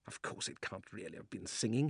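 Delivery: hushed, to himself; quiet muttering. Acted. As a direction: mutter it under your breath. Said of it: "he thought".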